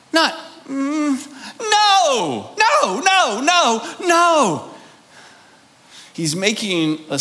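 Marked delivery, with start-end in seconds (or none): 0.1-4.7 emphatic no. Real, not acted. Answering own rhetorical question emphatically.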